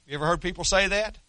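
normal talking voice

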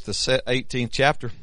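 normal talking voice

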